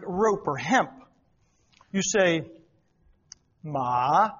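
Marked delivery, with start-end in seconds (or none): 3.6-4.3 MA low, dipping then lifting; third-tone word. Shown as a demonstration. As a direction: stretch ma down low and back up. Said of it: "and that means horse".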